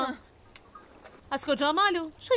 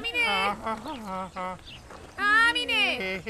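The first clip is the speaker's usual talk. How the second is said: calling out; 4 semitones lower, much slower than usual. Acted. Boy calls his sister from outside to come help. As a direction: call her name from a distance.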